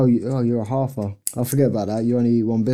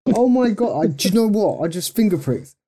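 excited; 8 semitones higher than usual. Real, not acted. Excited about matching readings.

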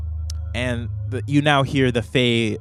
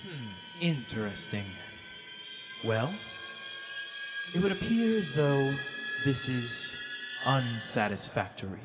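fae queen voice; 13 dB quieter than usual. Acted. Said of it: "the queen goes".

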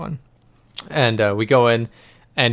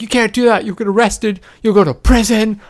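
higher, louder mock scolding; scolding mom; 11 semitones higher, 5 dB louder than usual. Mom scaring her kid about prison, in a higher loud mock scold. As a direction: threaten like an alarmed mother.

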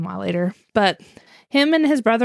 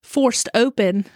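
slow, clear Forced open; correcting a mishearing, clear and slow; slow correction; slower than usual. Shown as a demonstration. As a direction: spell out Forced open slowly. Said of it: "poured soap in a window?".

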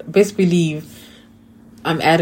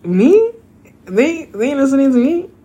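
much higher and louder, playfully outraged; mock indignant disbelief; 10 semitones higher, 6 dB louder than usual. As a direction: squeal it like you can't believe they ignore you. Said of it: "I'm like".

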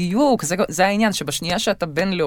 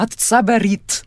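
put-on higher, slow, drawn-out Russian accent; 5 semitones higher, 3 dB louder, much slower than usual. Daughter mimicking her immigrant mother's claim, exaggerated Russian accent. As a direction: play up mom's Russian accent, stretching the words. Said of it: "זה כזה עם כל המבטא".

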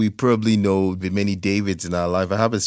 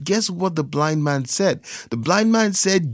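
normal talking voice

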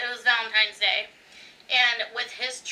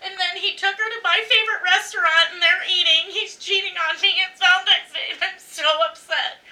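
higher, louder panicky imagined rant; 6 semitones higher, 4 dB louder than usual. Retold or imitated. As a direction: spin out an imagined cheating story in mock alarm. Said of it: "my brain's like".

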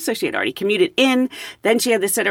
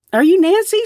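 eager question; 10 semitones higher, 4 dB louder than usual. Retold or imitated. Passenger greets at boarding door.

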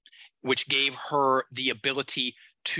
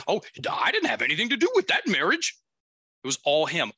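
defensive denial; 5 semitones higher, 4 dB louder than usual. Retold or imitated. Client's defensive denial, quoted.

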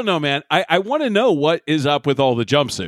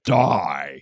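Worf voice; 12 semitones lower than usual. Retold or imitated. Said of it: "Worf is like".

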